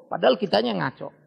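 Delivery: ordinary talk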